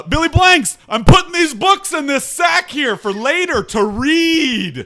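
loud and slow announcement; shouting. Acted. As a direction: bellow it out slowly. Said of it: "Skylar's laughing so hard".

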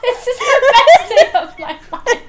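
delighted shriek, laughing; screaming laugh. Real, not acted. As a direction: squeal it with joy. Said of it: "that clip of you screaming".

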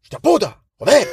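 high-pitched burst of cursing; bigger muttered anger. Acted. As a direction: act out a dad swearing as he walks off. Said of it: "el enfado es como más grande".

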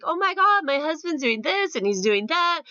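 high, bubbly retelling of her joy; imitating an overjoyed woman; happy excited quote. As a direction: gush like a thrilled friend. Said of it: "I remember just laughing. She was so happy".

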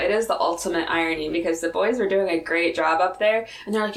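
ordinary delivery

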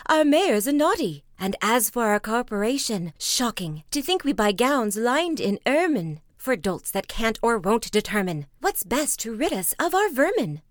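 loud, indignant townsfolk berating the mayor; a crowd shouting its complaint; angry crowd. Acted. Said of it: "cried they".

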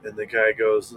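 ordinary talk

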